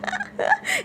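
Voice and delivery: very high laughing squeal; shrieking giggle. Real, not acted. Teased by chat.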